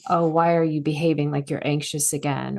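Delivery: ordinary talk, nothing marked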